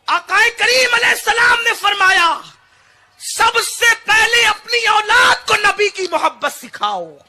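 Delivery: high, loud proclamation at full force; impassioned preaching. Real, not acted. Preacher proclaiming a hadith to the congregation, full-voiced shout. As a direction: thunder it out to the hall.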